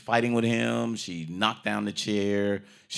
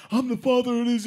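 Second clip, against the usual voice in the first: high loud put-on voice; mock son voice; 6 dB louder, faster than usual. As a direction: blurt it in a silly voice. Son's retold claim.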